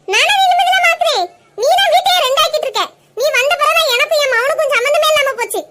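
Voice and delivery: mother-in-law rails at the daughter-in-law, outraged; shrieking accusation. Acted. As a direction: blame her for stealing your son. Mother-in-law escalates the quarrel.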